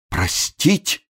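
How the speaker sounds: high, lost, rising question; bewildered babble. A narrator's reading. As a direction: echo "Простить" in helpless disbelief. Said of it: "растерянно пролепетал несчастный камергер". (растерянно переспрашивает с подъёмом)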